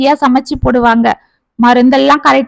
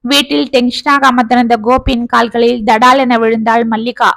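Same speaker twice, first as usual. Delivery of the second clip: ordinary delivery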